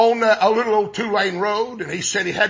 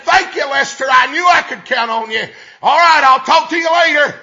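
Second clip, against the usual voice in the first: loud and higher, showing off; loud phone talk; 5 semitones higher, 7 dB louder than usual. Retold or imitated. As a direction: make sure the whole cafe hears this. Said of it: "talking loud enough everybody in there could hear him".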